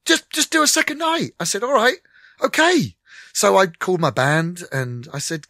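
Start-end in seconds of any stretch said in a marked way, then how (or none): 0.1-3.0 high, raised back-and-forth; settling it on the spot, thrilled; excited exchange. Retold or imitated. Excited exchange shouted to street.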